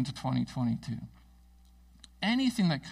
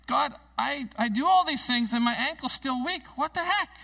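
higher, louder exasperated complaint to God; frustrated plea; 7 semitones higher, 4 dB louder than usual. Retold or imitated. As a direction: vent your frustration at God, rising to the last line. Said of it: "and I just thought".

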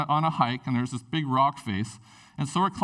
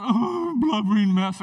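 a hammed-up sob, louder; mock blubbering; 5 semitones higher, 4 dB louder than usual. Pastor acts out crying in front of mom, hammed-up sob. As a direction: fake a teary breakdown.

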